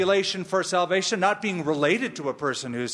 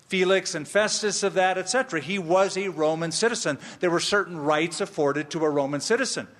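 ordinary talk

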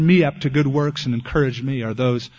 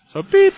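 high squeaky mock bleep noise; censor bleep; 8 dB louder than usual. Pastor bleeping out a visitor's swearing, high mock beep.

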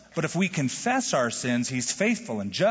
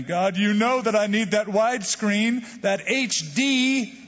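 pleading mock prayer; 6 semitones higher than usual. Believer begging God for a widescreen TV, mock pleading. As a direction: beg God earnestly, hammed up.

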